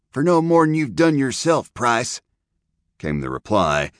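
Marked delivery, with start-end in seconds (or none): 0.1-2.2 put-on higher, bitter young rider. A narrator's reading. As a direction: spit it back with bitterness. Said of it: "in a young, bitter voice".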